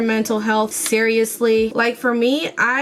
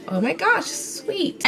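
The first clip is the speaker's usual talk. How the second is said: mock delight; 8 semitones higher, 4 dB quieter than usual. Mock gushing at a compliment.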